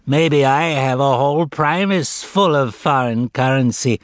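lightly whining fat cat; whiny voice. A narrator's reading. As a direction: whine it out, aggrieved. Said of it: "in a whiny voice".

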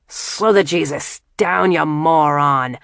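loud, furious shout at the driver; angry yell. Acted. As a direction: bark it like a panicked drunk passenger. [name] yells at reckless driver.

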